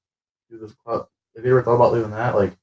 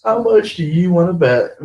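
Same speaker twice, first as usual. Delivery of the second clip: louder, higher challenge to a bet; insistent repeat; 5 semitones higher, 6 dB louder than usual. Real, not acted. Friend repeating a bet to the co-host, insistent. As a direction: push the bet harder, voice raised.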